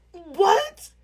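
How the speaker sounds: high, rising squeak of disbelief; incredulous mock yelp; shocked disbelief. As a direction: retell it as a stunned, high-pitched question. Retells her shocked reaction.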